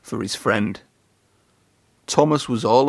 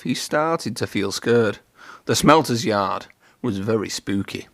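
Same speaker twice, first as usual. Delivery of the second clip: ordinary delivery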